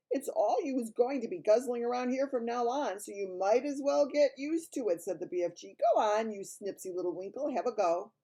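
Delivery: big friendly giant voice; kindly giant voice; BFG giant voice. Acted.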